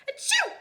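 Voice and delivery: loud high sneeze. A narrator's reading. Jack sneezing from hat dust, loud sneeze. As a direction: sneeze it out big. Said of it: "Jack sneezed".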